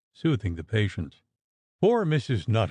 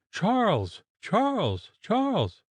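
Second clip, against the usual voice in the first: shrieking a name; 11 semitones higher, slower than usual. Acted. Mistress jumping from bed calling her husband, mild shriek. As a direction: call her husband in mounting panic.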